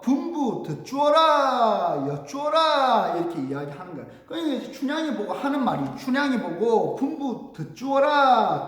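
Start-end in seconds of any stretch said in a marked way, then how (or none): 0.0-3.1 long, stretched vowels on a higher pitch; loud drawn-out proclamation; pansori recitation. Acted. Teacher declaims the pansori text. 7.0-8.6 raised, drawn-out proclamation; herald's order, higher pitched; pansori recitation. Acted. Teacher re-declaims the quoted order.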